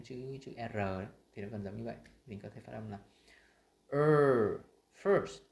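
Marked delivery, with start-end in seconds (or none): 3.9-5.4 "er" loud, higher and held, then "first" punched; stressed er. Shown as a demonstration. Teacher showing the stressed sound, forceful demo. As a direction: hit the vowel in "er" and "first" with full stress. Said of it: "những cái từ mà được nhấn trọng âm".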